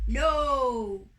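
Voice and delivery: loud, drawn-out no; big godly shout of no; sky god cry. A narrator's reading. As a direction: bellow a long refusal. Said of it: "cried the sky, sky god".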